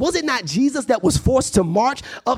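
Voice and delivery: high, rhythmic sermon cadence; impassioned preaching. Real, not acted. Preacher builds rhetorical questions.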